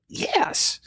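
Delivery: put-on whispered toddler yes; a small, sulky breathless yes; a child answering her kneeling dad, frustrated; mock frustrated toddler. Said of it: "in a very frustrated tone".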